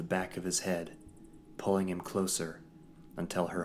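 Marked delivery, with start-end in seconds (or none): none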